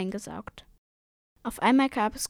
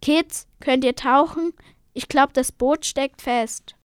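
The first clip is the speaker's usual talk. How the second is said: loud, raised call; 3 semitones higher, 7 dB louder than usual. Acted. Captain calling the kids to dive, shouting over. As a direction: call to them from a distance. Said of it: "hörten sie ihn rufen".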